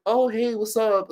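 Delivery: high, stretched-out upbeat fake Canadian greeting; fake Canadian accent. Liar greeting the Canadian family, fake Canadian accent. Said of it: "I put on a little fake Canadian accent".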